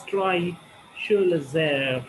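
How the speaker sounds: Drei Schulesser split with a pause between the words; split phrasing. Shown as a demonstration. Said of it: "make some pause in between".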